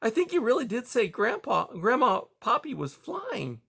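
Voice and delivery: put-on high child voice, half whispering; little boy stage whisper. A narrator's reading. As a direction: whisper so the uncle hears, like a small boy. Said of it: "Spruce whispered loud enough for Aerith to hear".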